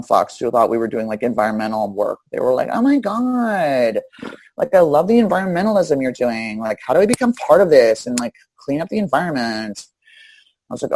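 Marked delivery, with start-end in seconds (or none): 2.7-9.9 gushing passerby. Retold or imitated. Clueless passerby praises the cleanup crew, high gushing voice. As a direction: play the dumb fan of environmentalism, pitched up.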